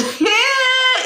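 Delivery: a high, shouted burst of laughter. Real, not acted. Laughs at her own crying.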